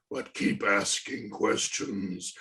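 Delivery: ordinary delivery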